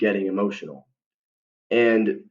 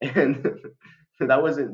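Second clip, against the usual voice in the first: laughing; 5 semitones higher, slower than usual. Real, not acted. Laughing at own awkward slip.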